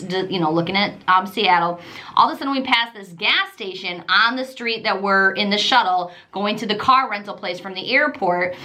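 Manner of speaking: ordinary talk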